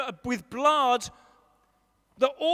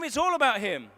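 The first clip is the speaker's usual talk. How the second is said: ordinary talk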